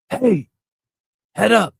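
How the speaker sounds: sharp, high, loud call to stop them; warning shout. Retold or imitated. Parent warning the kids about a car in the parking lot, shouting. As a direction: snap their attention with a yell. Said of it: "There's times to yell".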